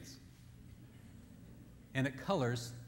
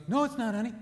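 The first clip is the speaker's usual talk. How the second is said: higher, louder quick denial; husband reassuring; 8 semitones higher, 5 dB louder, faster than usual. Retold or imitated. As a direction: blurt it out to comfort her. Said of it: "He goes".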